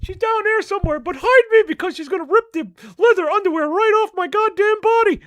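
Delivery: a cheeky stoner puppet griping about the dog; Mister Rob puppet voice. Acted.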